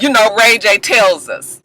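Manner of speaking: usual speaking voice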